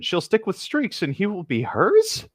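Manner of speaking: usual speaking voice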